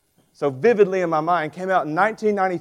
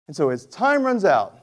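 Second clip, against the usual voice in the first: usual speaking voice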